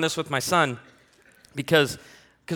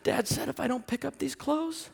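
put-on much higher, softer voice of a weepy kid; crying child; 11 semitones higher, 4 dB quieter than usual. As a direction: sniffle it out like a scared boy. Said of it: "And he goes".

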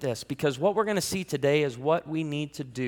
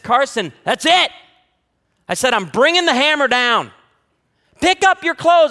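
much higher and louder stern dad shout; angry dad yelling; 9 semitones higher, 9 dB louder than usual. Retold or imitated. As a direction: bring the hammer down on the kid. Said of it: "I got worn out one day".

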